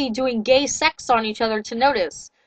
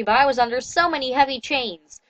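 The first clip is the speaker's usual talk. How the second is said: ordinary delivery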